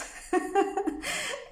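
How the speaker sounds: long high laugh; high giggle. Real, not acted. Laughing at her own mock rejection, a high giggle. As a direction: let a bright laugh ring out.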